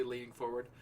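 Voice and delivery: normal talking voice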